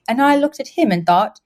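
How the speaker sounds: prim English understatement, drawn out at the end; passionless British. Retold or imitated. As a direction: imitate a reserved upper-class British woman. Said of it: "very passionless, but very stable".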